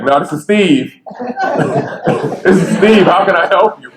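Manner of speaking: high, laughing mock phone answer; laughing joke. Bobby joking with the state lady on the phone, high and laughing. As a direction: fool around answering the phone.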